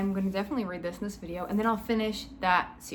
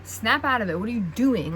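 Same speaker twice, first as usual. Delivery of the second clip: loud, exasperated scolding; frustrated; 5 dB louder than usual. Real, not acted. Reader yells at the heroine to wake up, frustrated. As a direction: shake some sense into her, loudly. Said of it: "I'm actually so frustrated at D M".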